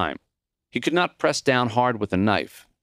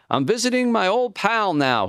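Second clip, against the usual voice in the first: higher, bright proud announcement; announcing proudly; 12 semitones higher than usual. A narrator's reading. Maury announcing to Connie he has a visitor, higher and proud. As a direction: announce it to the whole room with pride. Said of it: "he announced".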